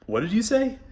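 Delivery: high, startled disbelieving question; surprised. Retold or imitated. Retelling his shock at a singer's word, high mock surprise. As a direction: ask like you can't believe your ears. Retold surprise at her pronunciation.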